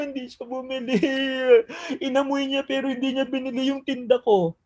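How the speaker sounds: hammed-up weepy complaint, half mocking; imitating a sobbing vendor; mock crying vendor. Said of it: "nag-iiyak? Nag-i-emotional?".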